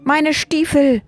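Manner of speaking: high-pitched cry of outrage; bellowing. A narrator's reading. Wichteltod loses his boots, bellowing. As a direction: yell it as a robbed villain. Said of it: "brüllte Wichteltod".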